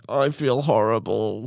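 slow, drawn-out mock groan of regret. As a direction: moan it like a sorry glutton. Regretting KFC the next day.

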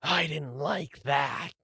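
slow, drawn-out monster voice; displeased golem. Acted. Golem regaining itself after the fire, displeased. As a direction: grumble it slowly as a golem. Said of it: "and then the golem".

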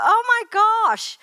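high, loud, drawn-out exclamation; hammed-up horrified realisation; acted shock. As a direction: exclaim in mock dismay, slowly and high. Acts out her shocked thought.

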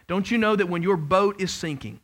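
normal talking voice

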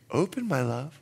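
soft, low tender call; gentle plea. Retold or imitated. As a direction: woo her quietly. Husband at door, gentle.

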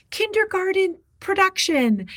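put-on high, dainty little-kid-show voice; mock-precious squeak of a voice; cutesy mock-grand. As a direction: pitch it way up, twee and playful. Mimicking a cutesy little school play.

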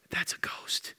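hushed aside to fellow disciples; whispered aside. Acted. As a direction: whisper it to the others. Turn around to the rest of the guys.

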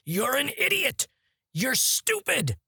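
high, drawn-out mock outburst; imitated anger. Co-host quoting Burt Reynolds' lines from the movie, mock angry. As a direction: act out clumsy anger, pitched high and slow. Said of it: "'cause he's getting angry at".

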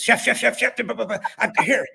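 high, breathless repeated calling; excited stammer. Retold or imitated. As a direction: play the eager friend bursting in. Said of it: "stuttering had become even more pronounced".

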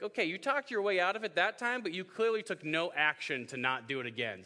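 cop voice. Pretend.

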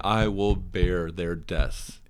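slow, grave mock-heroic vow; solemn vow. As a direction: pledge it like an epic leader.

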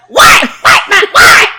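very high, loud, shrill-edged "What?"; mock brat shouting at mum; bratty kid what. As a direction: yell it like a rude little kid. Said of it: "my kids would be like".

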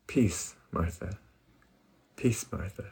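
soft, slow, soothing "Peace, Martha"; calm gentle Jesus. Retold or imitated. Jesus calming busy Martha, soft and slow. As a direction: hush her worries softly and slowly. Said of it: "He goes".

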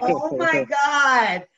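slow, high, drawn-out oh my God; mock exasperation. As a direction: stretch it out, playfully fed up. Reacting to producers' request.

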